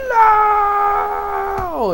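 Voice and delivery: very high, long drawn-out loud wail; hammed-up dramatic cry, held long; mock anguished scream. Yukio seeing Rin fall, exaggerated anguish.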